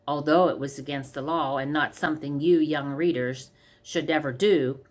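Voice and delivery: usual speaking voice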